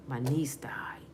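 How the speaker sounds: barely voiced, a breath of grief; whispered grief. Real, not acted. Speaking of losing her niece, whispered sorrow. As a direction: let the words fall away in a whisper. Grieving over family deaths.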